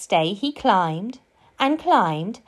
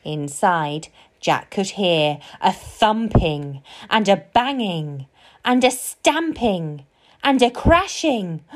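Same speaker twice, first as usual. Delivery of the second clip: usual speaking voice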